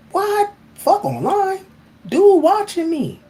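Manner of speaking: startled outburst. Real, not acted. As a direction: jolt up like you just got caught. Realizing son watches him.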